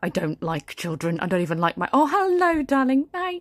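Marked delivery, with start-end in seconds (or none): 1.9-3.4 high, bright sing-song hello to her son; sweet mummy greeting. Acted. Mother greeting her little son at the party, sugary. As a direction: switch to a doting mother's voice.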